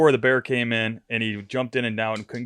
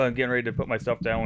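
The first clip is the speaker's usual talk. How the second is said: ordinary talk, nothing marked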